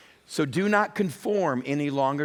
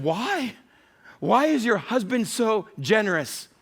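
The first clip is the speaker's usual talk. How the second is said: high, slow, drawn-out "why"; puzzled questioning; 7 semitones higher, slower than usual. Retold or imitated. Couple asking a wife about her generous husband, puzzled. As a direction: ask it high and incredulous, stretching it out.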